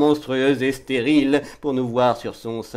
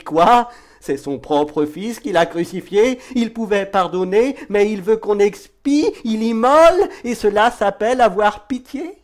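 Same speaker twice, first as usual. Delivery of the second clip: high, theatrical indignation, louder on key words; indignant outcry; 9 semitones higher, 4 dB louder than usual. Acted. Poet condemns a God who sacrifices his son, indignant. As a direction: build to an outraged, incredulous high-pitched question. Outraged challenge to God's cruelty.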